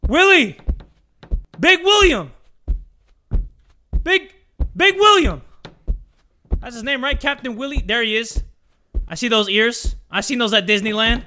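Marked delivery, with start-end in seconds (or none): 0.1-5.4 loud, high sing-out holler; high searching call. Real, not acted. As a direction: holler the name to find him. Calling out for game character.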